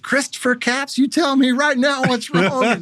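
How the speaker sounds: imitating a mom in a high-pitched, slow demand; alarmed mom. Mom woken at two a.m. questioning her son, high and urgent. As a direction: put on a high motherly voice, demanding an answer. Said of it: "I hear my mom like".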